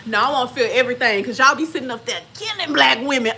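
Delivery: fast, loud and insistent; heated. Real, not acted. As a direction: push it hard and fast. Refusing the epidural, heated.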